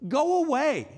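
loud, high brush-off; blunt dismissal. Retold or imitated. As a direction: wave the mourners off bluntly. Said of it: "very, like, blasé, like, he's almost indiscreet".

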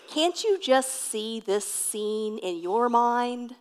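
ordinary talk

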